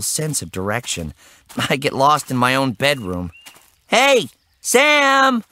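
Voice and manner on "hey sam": calling loudly across the woods; high ringing yell for his brother; shouting. Acted.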